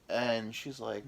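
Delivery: usual speaking voice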